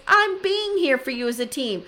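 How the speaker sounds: high-pitched, indignant imitated outburst; yelling. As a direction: throw it at him, acting out her anger. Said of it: "I'm yelling this into my computer".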